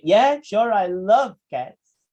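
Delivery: slow, drawn-out sarcastic "I love cats"; mean the opposite of love; dripping mock enthusiasm. Shown as a demonstration. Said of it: "sarcastic person".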